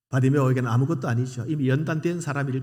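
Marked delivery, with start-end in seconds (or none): none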